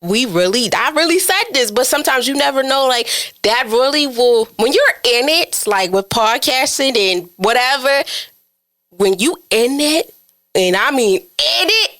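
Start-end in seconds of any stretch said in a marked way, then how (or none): none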